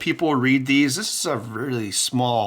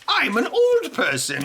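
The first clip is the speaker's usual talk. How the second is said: high, shouted mock old-timer; hammed-up elderly shout; old person voice; 13 semitones higher than usual. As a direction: yell it like a cranky senior. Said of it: "the same old person reading this".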